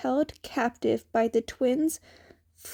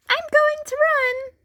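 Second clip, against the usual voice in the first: much higher, bright announcement; high Izuku; 6 dB louder than usual. A narrator's reading. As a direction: call it out high and eager.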